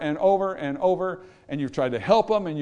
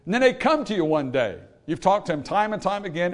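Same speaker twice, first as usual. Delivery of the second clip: ordinary talk, nothing marked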